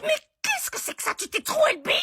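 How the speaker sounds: mother horrified at her daughter's new piercing; shocked outrage. Acted. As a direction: squeal it in disbelief.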